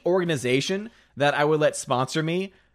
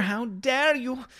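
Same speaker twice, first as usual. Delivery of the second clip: high, slow, hammed-up offense; mock outrage; 9 semitones higher, much slower than usual. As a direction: drag out the fake indignation.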